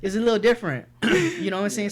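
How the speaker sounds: laughing through the words, high and loud; cracking up while agreeing. Real, not acted. Laughing as he agrees with his friend.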